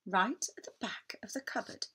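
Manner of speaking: hushed, secretive whisper. A narrator's reading. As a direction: lean in and whisper it. Mysterious build-up in story.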